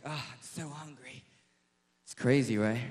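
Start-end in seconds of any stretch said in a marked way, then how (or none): 0.0-1.2 put-on faint, fading voice of a starving girl; barely able to speak from hunger; weak, starving whimper. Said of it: "She's like".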